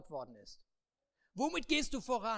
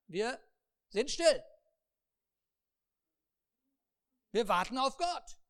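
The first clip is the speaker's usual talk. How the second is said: louder, higher declaration; mock obedience; 4 semitones higher, 7 dB louder than usual. The people loudly declare they will wait. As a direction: proclaim waiting as if proud.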